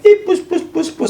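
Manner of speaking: high-pitched cat-coaxing call. Retold or imitated. Bruce calls the wary cat, high coaxing. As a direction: coax puss in falsetto.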